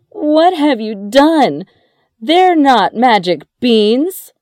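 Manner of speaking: high, loud scolding cry. A narrator's reading. Mother to Jack over the magic beans, crying out. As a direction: cry out in high-pitched dismay. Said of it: "his mother cried".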